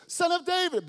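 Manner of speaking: high, loud cry, Son of David; blind man crying. Acted. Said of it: "Blind Bartimaeus".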